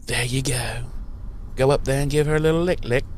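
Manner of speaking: quick, hushed sleazy coaxing; a hint of a smarmy vampire egging him on; fake Spike voice. Fake Spike coaxing his vampire pal, quick and hushed. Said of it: "the fake Spike is like".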